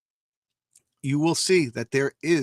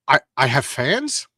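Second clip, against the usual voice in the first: bashful Spider-Man; 4 dB louder, slower than usual. Acted. Voicing Spider-Man in the comic.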